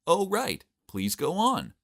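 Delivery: high-pitched, overdone show of interest; put-on eager listening, pitched well up; feigned interest. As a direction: pretend to be fascinated. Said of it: "I feigned interest by saying".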